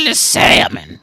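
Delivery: loud, unvoiced, strained croak; imitating her voice. Comedian mimicking the waitress saying the special, loud breathy rasp. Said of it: "That was her voice".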